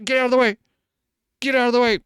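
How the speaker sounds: high, loud mock yell at people in the way; mock shout. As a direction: bark it out, clear the path. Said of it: "you could be like".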